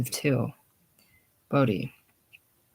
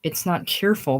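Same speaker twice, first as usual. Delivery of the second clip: ordinary talk, nothing marked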